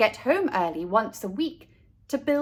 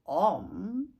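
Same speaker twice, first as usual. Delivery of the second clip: puzzled; 3 semitones lower, 3 dB quieter than usual. Acted. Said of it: "wondered Mister Buckmeister".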